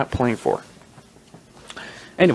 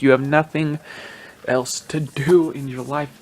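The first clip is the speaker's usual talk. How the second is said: drawn-out, exasperated rebuke; slow emphatic scolding; 2 semitones higher than usual. Real, not acted. Podcaster repeating his rebuke to media, slow emphatic scolding. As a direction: spell it out slowly and firmly.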